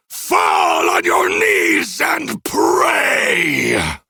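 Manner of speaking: slow, rising crazed roar; malicious rant. A narrator's reading. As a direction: rage like a dying god. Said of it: "the malice behind it was unmistakable".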